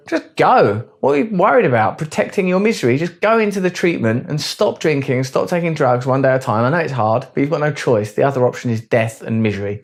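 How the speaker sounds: hammed-up tough-love pep talk; mock exasperated coach urging addicts into treatment.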